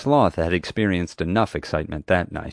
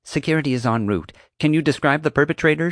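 higher-pitched, businesslike dispatcher; phone dispatcher; 8 semitones higher than usual. A narrator's reading. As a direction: voice a dispatcher asking for details. Dispatcher voiced in a story.

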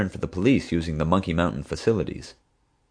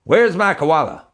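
much higher, louder, slow demand; furious demand; 11 semitones higher, 6 dB louder than usual. A narrator's reading. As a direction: bellow it at him.

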